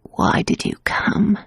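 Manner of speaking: slow, hoarse whisper; croak. A narrator's reading. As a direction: croak it weakly from the bed. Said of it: "she croaked".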